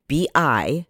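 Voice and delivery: B I, each letter said separately; spelling it out. Shown as a demonstration. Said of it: "I'm gonna spell it for you".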